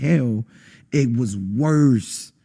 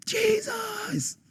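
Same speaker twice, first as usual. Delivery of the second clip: desperate cry; 7 dB quieter than usual. Retold or imitated. Calling on Jesus to escape hell, desperate cry.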